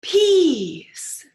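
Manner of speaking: loud, high horrified "Peas"; hammed-up horror at a vegetable; dread. Teacher reading aloud, dreading the peas.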